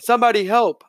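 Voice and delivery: high panicked call; urgent cry to the crowd; cry for help. A narrator's reading. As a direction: shout for help in alarm. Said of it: "I cried".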